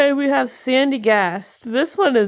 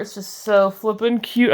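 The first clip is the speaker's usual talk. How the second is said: normal talking voice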